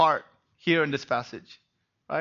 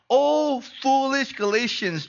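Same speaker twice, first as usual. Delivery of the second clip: exasperated; 6 semitones higher than usual. Acted. Preacher voicing exasperated Paul.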